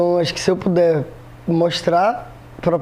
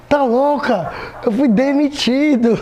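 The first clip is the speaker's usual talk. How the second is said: exasperated son; 6 semitones higher, slower than usual. Retold or imitated. Son correcting mother, exasperated.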